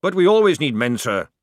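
loud, high-rising sergeant's objection; protesting. A narrator's reading. Sergeant objecting to his discharge, protesting loudly. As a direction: push back hard at the officer. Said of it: "Jackram protested".

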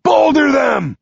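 sharp high shout across the cave; yelling command. Acted. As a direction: bark the order at full voice. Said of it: "Nine points at me and yells".

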